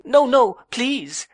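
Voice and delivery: high, slow, loud plea; cried out. A narrator's reading. Young wife cries out to stop her husband's lecture, high and loud. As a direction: cry out no, stretched and pleading. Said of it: "cried Dora with a kiss".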